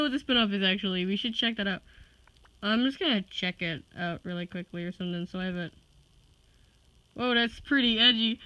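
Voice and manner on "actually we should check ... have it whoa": ordinary delivery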